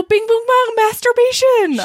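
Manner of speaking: high, loud comic announcement; playful high-pitched holler; mock exuberance. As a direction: belt out the silly phrase high and proud. Riffing loudly on a joke.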